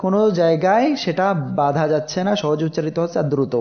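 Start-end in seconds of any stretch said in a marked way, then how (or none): none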